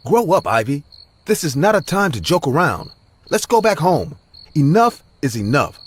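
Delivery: high, loud angry scolding. Acted. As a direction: snap at her to stop. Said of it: "He hurled at Ivy".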